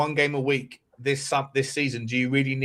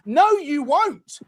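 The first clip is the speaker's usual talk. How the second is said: much higher, louder heated shout; 12 semitones higher, 4 dB louder than usual. Real, not acted. Heated football argument.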